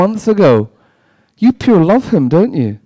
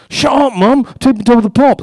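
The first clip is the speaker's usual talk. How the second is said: higher, louder bratty yell; teen yelling at mum; 9 semitones higher, 6 dB louder than usual. Retold or imitated. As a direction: snap at mum so the tape catches it. Teen yelling at mum while taping.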